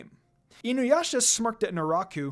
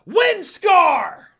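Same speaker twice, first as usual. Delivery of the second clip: much higher and louder attack yell; full-throated attack call; battle cry shout; 12 semitones higher, 11 dB louder than usual. A narrator's reading. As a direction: scream the attack name. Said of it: "Inuyasha yelled again".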